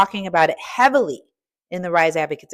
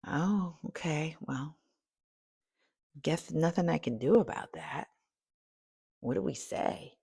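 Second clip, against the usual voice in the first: resigned mumble; 3 semitones lower, 13 dB quieter than usual. Retold or imitated. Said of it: "I was just like".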